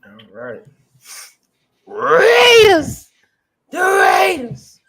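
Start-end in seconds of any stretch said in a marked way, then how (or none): 1.9-4.8 much higher, much louder, drawn-out cheer; excited shout. Real, not acted. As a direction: belt it like a fan in the stands. Hyping the Raiders pick.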